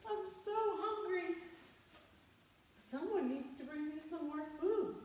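high, whiny hungry moan; high pleading whine for food; moaning hungry caterpillar. Acted. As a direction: moan like a starving little caterpillar. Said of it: "It's like".

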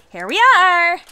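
proudly presenting the room; excited announcement. Acted. Roommate arrives at the room.

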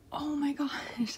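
breathy gasp into slow disbelief; shocked gasp. Real, not acted. Collector reacting to the card just pulled, shocked.

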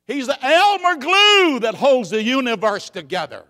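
loud, high, ringing preaching; preaching at full force. Acted. Preacher rousing the congregation, loud and high. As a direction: lift the whole church with it.

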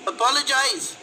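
put-on high-pitched, taunting girl; cruel smirking girl. A narrator's reading. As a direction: echo it cruelly in a high girl's voice. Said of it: "the girl echoed with a cruel smirk".

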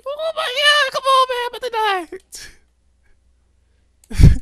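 very high, loud panicked scream; real frantic shriek at the game. Real, not acted. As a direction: shriek as death closes in. About to die in game.

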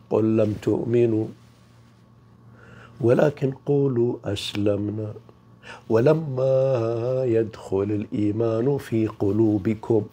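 slow measured recitation of the verse; Quran recitation. Acted. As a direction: recite it slowly, as in prayer. (تلاوة بطيئة مرتلة)

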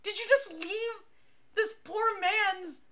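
can't believe they left it open; incredulous. Retold or imitated.

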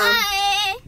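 high, loud yelled bye; shouted bye. Real, not acted. Kid yells bye.

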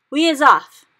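loud joyful cry. Acted. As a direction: shout it out excitedly. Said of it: "cried the B F G".

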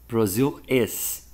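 "Brazil is" slowly spelled out; stress on Brazil is. Shown as a demonstration. Teacher isolating the subject and verb for students, slow. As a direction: underline "Brazil is" slowly for the class. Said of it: "Tá vendo? Olha".

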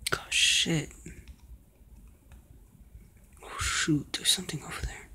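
hushed nervous whisper. Real, not acted. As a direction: whisper so nobody hears. Sneaking, hiding from a chaser.

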